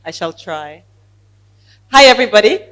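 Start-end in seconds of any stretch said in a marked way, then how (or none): 1.9-2.6 booming hello to the whole room, much louder; full-volume hello to show how loud she is; loud greeting. Real, not acted. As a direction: let your naturally big voice ring out. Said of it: "I have a very loud voice generally".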